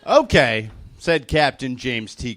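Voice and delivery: ordinary talk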